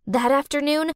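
usual speaking voice

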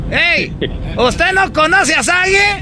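high, slow excited calling. Acted. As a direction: call out to the celebrity, thrilled.

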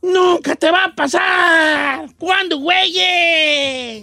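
NUNCA shouted out long and slow; a slow, hammed-up yell of "¡Nunca te va a pasar!"; dramatic slow shout. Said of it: "te lo tengo que decir".

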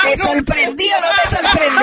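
high, loud laughing shout; gleeful shout. Acted. Host teasing the studio, laughing loud. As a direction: crow it with laughter.

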